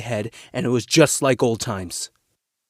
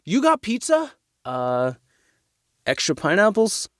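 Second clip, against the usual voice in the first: delighted; 7 semitones higher than usual. Acted. Son delighted about pizza.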